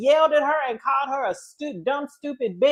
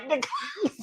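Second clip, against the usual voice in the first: laughing echo; 4 semitones higher, 10 dB quieter than usual. Retold or imitated. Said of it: "And we was like".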